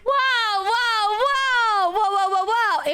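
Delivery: high, stunned wow and whoa; shocked. Real, not acted. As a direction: react in total disbelief. Shocked by the chat's remark.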